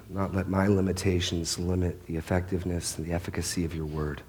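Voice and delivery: soft, quick prayer voice; quiet prayer. Acted. Pastor praying quietly before the congregation, hushed and reverent. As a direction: pray it hushed and humble.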